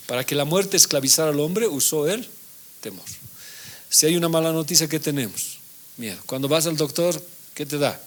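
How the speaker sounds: ordinary delivery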